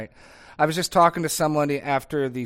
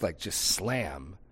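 normal talking voice

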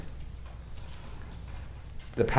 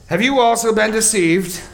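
high, loud sneering rebuke; mocking scold; 10 semitones higher, 6 dB louder than usual. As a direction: hurl it at the officers with scorn. Pharisees scolding the officers.

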